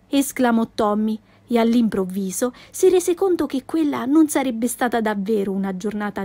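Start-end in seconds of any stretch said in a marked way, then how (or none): none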